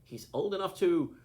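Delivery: loud high grim retort; emphatic. A narrator's reading. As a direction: drive the point home loudly.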